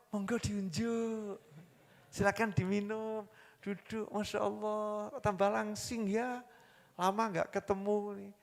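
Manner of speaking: mock-coo compliments at another woman's husband; flirty sweet-talking wife.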